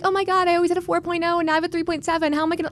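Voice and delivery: fast, high whiny panic over a tiny grade drop; mock overdramatic student. Mocking a student panicking over a three point seven, fast and high. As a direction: rattle it off like a spoiled straight-A student. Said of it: "no, that's not what I'm talking about".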